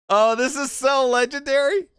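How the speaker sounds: high, loud burst of delight; thrilled and giggly. Real, not acted. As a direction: gush over the clip, laughing. Laughing at McGregor weigh-in clip.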